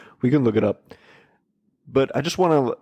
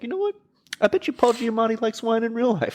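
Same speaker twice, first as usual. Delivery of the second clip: excited realization; 12 semitones higher than usual. Retold or imitated. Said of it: "I was like".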